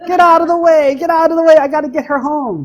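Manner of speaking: hammed-up nascar shout; mock yell. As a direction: yell at cars like a racer. Racing driver yelling.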